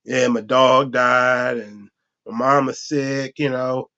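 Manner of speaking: low, sorry-for-himself whine; lightly voiced sad friend begging; mopey friend. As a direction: act down bad and pitiful. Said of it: "walk up to you like".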